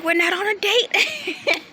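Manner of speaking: high, drawn-out disbelief breaking into laughter; laughing mock protest. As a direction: protest slowly, pitch soaring toward a laugh. Retelling her protest, laughing.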